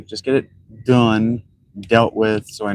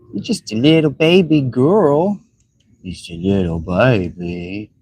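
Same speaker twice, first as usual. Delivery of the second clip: put-on condescending cooing baby voice; mocking baby talk; slower than usual. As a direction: coo at them like a toddler.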